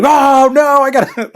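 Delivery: loud, high cry of fake alarm; mock panicked yell. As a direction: play-act a chest about to burst. Acting out chestburster prank victim.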